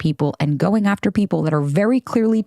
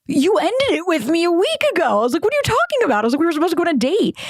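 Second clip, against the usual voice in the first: much higher, exasperated disbelief; incredulous; 11 semitones higher, faster than usual. Retold or imitated. Answering the ex who forgot dumping her, incredulous and high. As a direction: squeak it out like you can't believe him. Incredulous reply to his flirty DM.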